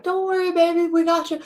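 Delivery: put-on high, cooing baby-talk to a dog; imitating an owner cooing to her dog; soothing the dog. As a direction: coax the dog like a worried owner.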